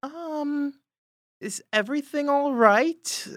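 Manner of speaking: a timid woman calling through the door; worried neighbor through door. Acted. Hotel worker knocking on the door to check in, high and timid. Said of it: "You hear".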